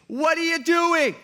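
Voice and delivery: exasperated, drawn-out rebuke; Paul angry. Retold or imitated. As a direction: demand it like an outraged apostle. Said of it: "Paul is mad".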